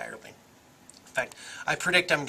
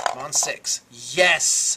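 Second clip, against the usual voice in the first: yelling at a horse race; 7 dB louder, much slower than usual. Real, not acted. Cheering on a dice roll.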